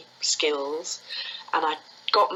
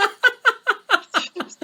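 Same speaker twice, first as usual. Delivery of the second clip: high, hearty laugh; real laugh; 5 dB louder than usual. Real, not acted. Teacher amused by her French-accent trick, laughing. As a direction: burst out in loud, high laughter.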